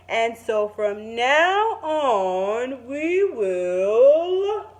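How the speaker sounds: gentle storybook narration; calm picture-book reading voice; reading to children. Acted. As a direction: read it like story time for kids. Teacher reads picture book aloud.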